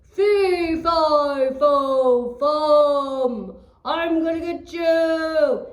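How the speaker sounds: loud booming giant threat; giant's roar. Acted. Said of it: "he says".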